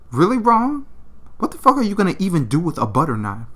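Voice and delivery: fast, loud, high scoff; incredulous. Acted. [name] mocking Ron for picking a butter knife, incredulous.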